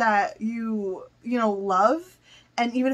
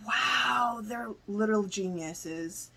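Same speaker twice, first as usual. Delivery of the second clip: awed hush; 2 semitones lower, 9 dB quieter than usual. Real, not acted. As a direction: murmur "they're literal geniuses" in awe. Awed praise said twice.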